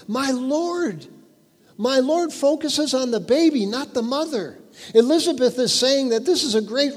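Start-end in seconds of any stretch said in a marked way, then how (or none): 0.1-3.7 My Lord lifted high, leaning on Lord; My Lord raised and stressed to focus on the baby. Shown as a demonstration. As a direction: preach the words My Lord up and out. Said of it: "The emphasis is on Elizabeth's Lord".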